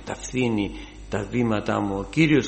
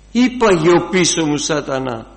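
loud, high commanding cry at Satan; shouted rebuke; 6 semitones higher, 11 dB louder than usual. Acted. Preacher commands Satan before the congregation, shouting. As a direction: throw the rebuke out at full voice.

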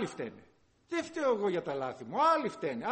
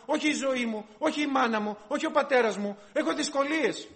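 preaching at full force; 3 semitones higher, 5 dB louder than usual. Acted. Preacher exhorting brothers to admit their own fault. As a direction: hammer it home to the congregation.

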